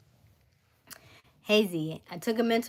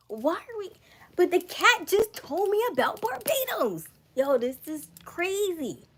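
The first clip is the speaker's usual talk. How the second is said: much higher, excited disbelief; amazed; 10 semitones higher than usual. Real, not acted. Learning the jet detours to Barbados, amazed. Detour matches cat's prediction.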